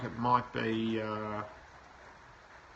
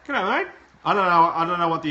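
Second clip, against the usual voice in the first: loud, high-pitched Australian g'day; mock-broad Australian accent; Aussie greeting; 7 semitones higher, 11 dB louder, faster than usual. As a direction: belt out a hammed-up Aussie hello. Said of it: "say that with an accent".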